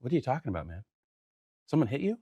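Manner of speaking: soft, feigned innocence; straight-faced denial. Retold or imitated. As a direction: play dumb, quietly puzzled. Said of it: "I'm keeping a straight face".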